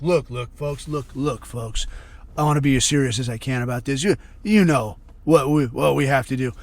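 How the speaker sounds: low, earnest politician imitation; mock very serious. Mimicking him addressing the folks, mock-serious low voice. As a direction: drop low and plead grave sincerity. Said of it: "he's coming off very seriously".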